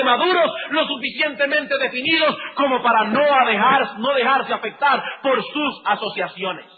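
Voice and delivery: high, loud preaching at full force; impassioned sermon climb; fiery preaching. Acted. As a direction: drive it home from the pulpit.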